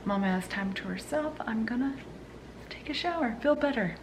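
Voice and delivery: quiet whispered inner thought; hushed, to yourself; whispering. Retold or imitated. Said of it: "and then I was like".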